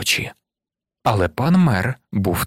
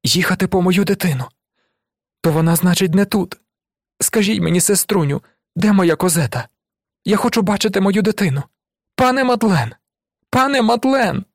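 high, distraught mother's pleading; frantic high voice building in pitch; crying out; 9 semitones higher than usual. A narrator's reading. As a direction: plead desperately, climbing to a high wail on the name. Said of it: "скрикнула вона".

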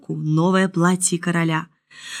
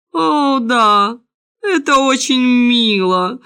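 lightly voiced king, higher and drawn out; slow, raised-pitch royal approval; approving king; 4 semitones higher, 4 dB louder, slower than usual. A narrator's reading. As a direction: stretch the praise out, regally approving.

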